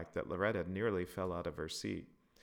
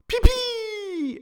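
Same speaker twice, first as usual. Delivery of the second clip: put-on high squeaky child's shriek; loud high kid squeal; little girl exclaiming; 17 dB louder than usual. A narrator's reading. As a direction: shriek it like a giddy four-year-old. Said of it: "exclaimed Elise's four-year-old sister".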